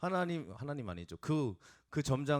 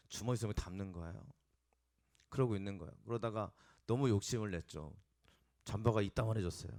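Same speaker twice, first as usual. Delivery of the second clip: usual speaking voice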